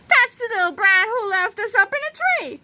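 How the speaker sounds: high, screechy accusing voice; angry old ladies. A narrator's reading. Old ladies accusing Melvin, angry shouting. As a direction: complain high like an outraged old lady. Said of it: "shouted a couple of very angry old ladies".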